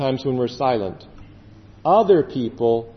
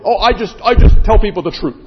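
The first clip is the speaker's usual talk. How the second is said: loud, blunt truth-teller imitation; loud, higher-pitched mock bluntness; harsh truth-teller; 4 semitones higher, 9 dB louder, faster than usual. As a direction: mimic someone bluntly insisting on honesty. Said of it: "in a very harsh way".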